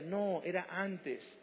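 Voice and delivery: NO drawn out, very slow and falling; emphatic correction. Real, not acted. Preacher corrects the flock, slow and emphatic. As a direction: hammer home that it was before.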